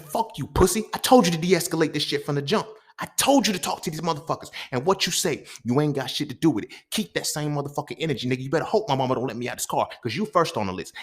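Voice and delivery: high, loud enraged shouting; furious retold tirade. At the friend who touched his chest, furious shouting. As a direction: let him have it, no holding back.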